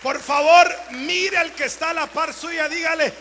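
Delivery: loud, high shouted exhortation; preaching at full force. Acted. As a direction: rouse the congregation with a shout. (predicando a gritos con fuerza)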